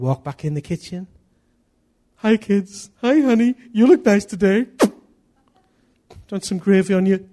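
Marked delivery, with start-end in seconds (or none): 2.2-7.3 high, loud, hammed-up cheer; cheerful greeting. Father back in the kitchen with peace, brightly cheerful. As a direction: greet the family in a put-on chirpy voice.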